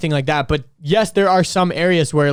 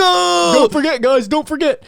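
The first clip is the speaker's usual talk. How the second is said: mock announcer; 10 semitones higher, slower than usual. Joining the mockery.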